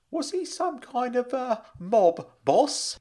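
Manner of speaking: high voice, groping slowly for the term; hesitant, suspicious uncle. Acted. Uncle asks about Frank's cellmate, high and hesitant.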